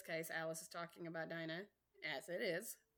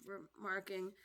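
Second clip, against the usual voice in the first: ordinary delivery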